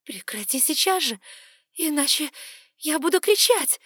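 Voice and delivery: loud, high panicked warning; frightened threat. Acted. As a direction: threaten to scream, rising. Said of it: "пригрозила я".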